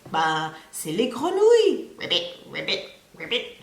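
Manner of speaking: lightly voiced frog puppet; Jean puppet voice. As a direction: play the puppet naming his topic. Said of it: "his favourite subject is frogs".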